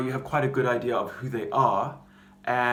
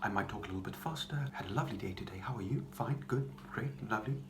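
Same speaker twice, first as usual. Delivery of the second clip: soft nervous chatter with clipped consonants; contained, awkward small talk, very quiet; 9 dB quieter than usual. Shown as a demonstration. Nervous person making small talk about a lovely day, clipped and awkward. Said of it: "nervous person, very awkward and contained".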